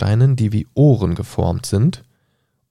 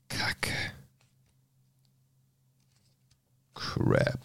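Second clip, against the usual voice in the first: soft unvoiced swear under the breath; muttered curse; 11 dB quieter than usual. Real, not acted. Reader loses the page, swears under breath. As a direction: grumble to yourself. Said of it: "reader curses losing page".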